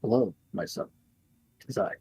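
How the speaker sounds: hushed, far-off holy voice; soft divine voice. Acted. GM as Jesus greeting Andrew, hushed and otherworldly. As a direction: speak softly like a god from beyond.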